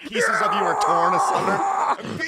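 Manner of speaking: slow, high, booming doom shout; dramatic shout. Pretend. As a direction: wail it out like a horror trailer. Mock horror of transformation.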